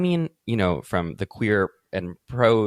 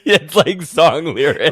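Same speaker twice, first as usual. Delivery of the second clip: loud, drawn-out words through laughter; laughing; 6 semitones higher, 8 dB louder than usual. Real, not acted. Joking to co-host about knowing tweets, laughing. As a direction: laugh it out, higher and louder. Both hosts cracking up.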